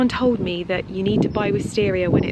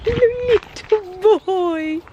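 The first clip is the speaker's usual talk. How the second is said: pet talk voice; 10 semitones higher, much slower than usual. Real, not acted. Cooing at her cat while watering, slow high baby talk. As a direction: stretch it out in a tiny cooing voice.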